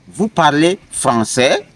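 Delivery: "français" swept up at the end; rising question tone. Shown as a demonstration. Teacher showing questions without inversion, rising question tone on "français". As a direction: make it a question by raising "français". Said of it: "you can just raise your tone".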